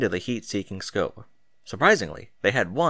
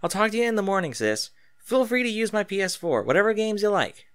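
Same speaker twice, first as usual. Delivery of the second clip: higher, easygoing big-sister sign-off; lightly voiced, casual goodbye; Arya's voice; 10 semitones higher than usual. A narrator's reading. Arya tells her sister to use her PS4, casually voiced.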